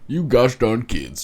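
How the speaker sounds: a lightly voiced, slow grumpy seventies dad; dad dismissing his daughters' story. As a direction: scold the kids like an old-fashioned dad, slowed down. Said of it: "dad's just being like".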